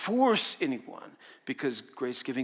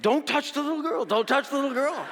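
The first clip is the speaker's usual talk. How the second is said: wife's laughing warning; 12 semitones higher, 6 dB louder than usual. Retold or imitated. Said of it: "my wife was there".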